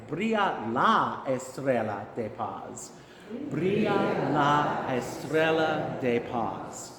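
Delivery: Spanish phrase said slowly with a clear LA; corrected slow Spanish pronunciation. Shown as a demonstration. Choir director trying that again, slow Spanish pronunciation. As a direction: model la estrella slowly, syllable by syllable. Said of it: "I'll try that again".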